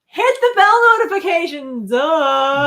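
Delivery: high playful shout. Pretend. Cheeky sign-off.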